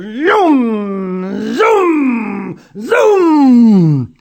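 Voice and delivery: high, loud zooming cries; zooming sound. A narrator's reading. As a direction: belt out each zoom like a racing engine. Child zooming in wheelchair.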